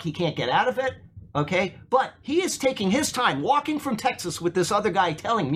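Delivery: ordinary talk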